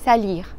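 "salir", French uvular R, no rolled R. Shown as a demonstration. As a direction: say "salir" with the R sounding close to a J. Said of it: "la R del verbo salir en francés se pronuncia como una J".

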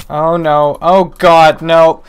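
rising panicked wail; despairing shout. Real, not acted. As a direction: yell in panic as it goes wrong. Losing fight to trident enemy.

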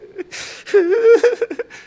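high, loud mock sobbing; high-pitched acted bawling; imitated crying. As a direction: burst into staccato wails like a man crying. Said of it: "he just started crying".

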